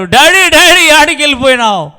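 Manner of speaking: high, loud sobbing cry, rushing the question; cling on and wail out the relief. Acted. Son finding his father again, crying loudly. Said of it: "పట్టుకొని గట్టిగా ఏడ్చాడు".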